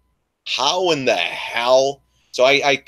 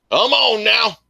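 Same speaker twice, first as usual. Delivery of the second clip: exasperated plea; 10 semitones higher, 3 dB louder than usual. Real, not acted. Exasperated at trader.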